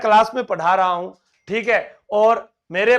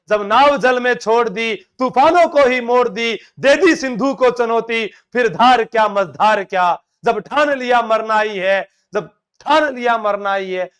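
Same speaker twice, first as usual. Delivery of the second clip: rousing verse recital; 2 semitones higher, 5 dB louder than usual. Shown as a demonstration. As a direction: declaim it loudly to fire up the class.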